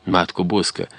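usual speaking voice

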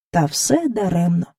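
ordinary delivery

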